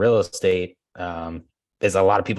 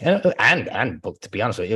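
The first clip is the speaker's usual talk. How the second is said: usual speaking voice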